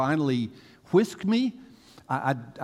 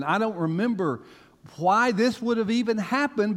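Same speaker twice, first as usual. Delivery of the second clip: ordinary talk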